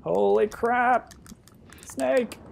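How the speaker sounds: high, loud yelp of surprise; startled alarm. Real, not acted. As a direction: cry out as if something jumped at you. Player startled by a snake.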